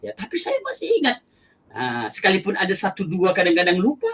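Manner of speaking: ordinary delivery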